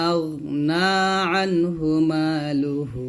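slow, long held vowels, bouncing final b; Quran recitation with qalqalah. Shown as a demonstration. Teacher models stopping on the verse, slow recitation. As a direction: recite slowly, echo the b of কাসাব.

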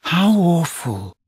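put-on high, slow girlish voice trying not to smile; a drawn-out half-amused gasp; lightly voiced young girl, half laughing; Wendy, dismayed but amused. A narrator's reading. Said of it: "could not help smiling".

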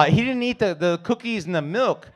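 put-on childlike voice. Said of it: "And I'm all".

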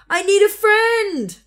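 high, slow, sad call; lonely call. A narrator's reading. Little beaver admitting he is lonely, high slow call. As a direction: let the loneliness carry across. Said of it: "he said".

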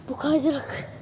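ordinary talk

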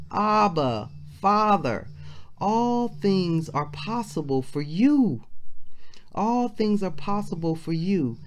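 impassioned preaching. Acted. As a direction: plead to the Father from the pulpit. Voices Jesus praying, preaching force.

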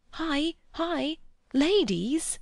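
high, alarmed calling; urgent warning. A narrator's reading. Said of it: "Mister Beebe's last warning".

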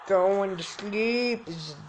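drawn out, sing-song; slow sing-song. Acted. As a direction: stretch every word slowly.

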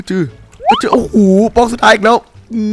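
very high loud yelp then groaning shout; exasperated yelp. Real, not acted. Gamer missing the final block again, exasperated high yelp.

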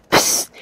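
loud hissed psst; a sudden, explosive "psst"; sharp psst. Acted. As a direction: cut in with a forceful sibilant hiss. Spelt interjection cutting in.

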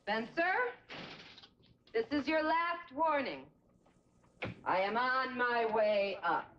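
slow, high stern mother; stern warning. Acted. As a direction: drag out the last warning.

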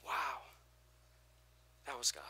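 softly amazed, voice dropping; hushed awe. Retold or imitated. Preacher showing the congregation awe of God, hushed. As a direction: breathe out the wonder quietly. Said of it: "When you're just like".

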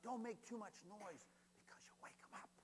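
urgent stage whisper; hushed warning. Acted. Warning children not to wake God up, hushed whisper. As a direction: whisper it so the sleeper won't hear.

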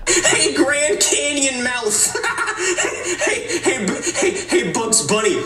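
high, giggly name-calling between cackles; gleeful mocking through laughter; laughing taunts. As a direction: heckle while cracking yourself up. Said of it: "You better stop laughing".

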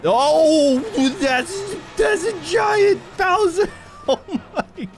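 surprised shout. Real, not acted. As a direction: shout it out, truly startled by the giant. Dad sees giant Bowser in game.

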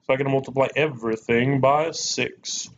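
ordinary delivery

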